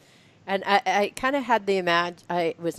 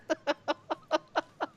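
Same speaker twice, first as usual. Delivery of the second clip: high laugh; 7 semitones higher, 7 dB quieter than usual. Real, not acted. Laughs after mock quote.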